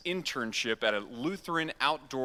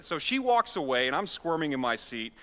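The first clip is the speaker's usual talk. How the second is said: ordinary talk, nothing marked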